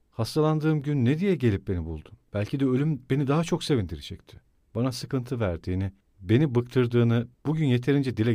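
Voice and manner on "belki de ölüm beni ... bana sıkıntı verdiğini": plain reading voice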